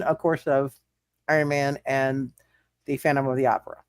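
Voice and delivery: ordinary delivery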